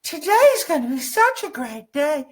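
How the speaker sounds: high-pitched, gushing enthusiasm; gushing variation. Shown as a demonstration. Coach playing with ways to say it, high gushing. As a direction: gush it way up high in a put-on voice. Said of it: "I don't mind how you say it".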